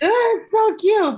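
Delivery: slow, high squealed "it's so cute"; delighted squeal; gushing. Real, not acted. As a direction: drag it out adoringly. Gushing about merch.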